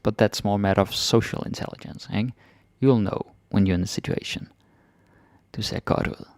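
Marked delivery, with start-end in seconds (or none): 5.6-6.3 whispered. Shown as a demonstration. Whispered repeat.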